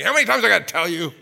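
loud, fast exasperated shout; dad yelling at his son, loud and fast; imitated dad yelling. Dad scolding his son, loud exasperated shout. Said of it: "my dad used to yell at me".

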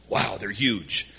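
low, slow, hushed wonder; acted awe. Marchers looking at those walls, low awed wonder. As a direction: take in the size, slowly.

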